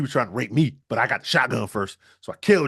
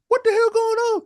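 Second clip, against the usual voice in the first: much higher, louder alarmed mom voice; shrill shocked mom; 5 dB louder than usual. Acted. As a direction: squeal it as a startled mother. Mom bursts into room.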